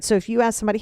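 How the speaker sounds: normal talking voice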